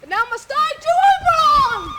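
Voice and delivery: a sudden loud burst; loud declaration. Acted. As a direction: cast the line out like a spell. Puck's spell.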